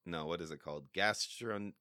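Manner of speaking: ordinary talk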